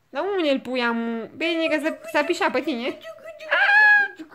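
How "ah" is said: loud, very high shriek; high squeal. Real, not acted. Reacting to the puppy, loud high squeal.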